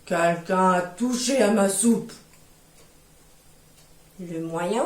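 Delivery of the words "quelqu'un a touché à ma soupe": low, slow big-bear rumble; the papa bear, low and heavy, complaining about his soup. Acted. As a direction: sound like a huge gruff father bear. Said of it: "Et le papa ours dit".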